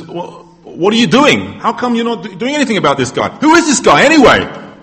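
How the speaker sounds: high-pitched, incredulous questioning; indignant young David. Acted. Young David challenges idle soldiers about Goliath, high indignant voice. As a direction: challenge them in rising disbelief. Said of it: "he says, he says".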